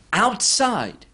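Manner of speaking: "outside" lifted high and drawn out; stress on outside. Shown as a demonstration. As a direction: lean hard on "outside". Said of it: "the emphasis is on outside".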